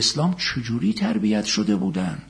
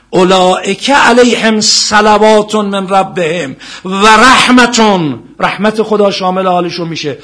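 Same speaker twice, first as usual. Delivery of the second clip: preaching at full force; 7 semitones higher, 10 dB louder than usual. Acted. Preacher to congregation, impassioned loud sermon. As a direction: drive it home to the crowd.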